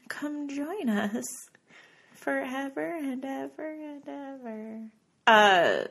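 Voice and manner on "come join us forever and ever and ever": high, slow ghostly wail; haunted house actor. Pretend. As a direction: beckon like a spooky ghost. Ghostly invite to Facebook group.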